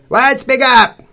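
high, loud holler; loud demand. A narrator's reading. Stout lady who cannot hear, shouting. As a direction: holler it over a blaring TV.

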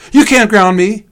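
high, loud protest; defiant child. A narrator's reading. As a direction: shout back like a defiant little brother. Sam shouts back at Leon.

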